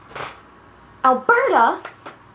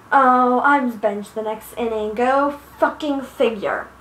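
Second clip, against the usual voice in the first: ordinary talk, nothing marked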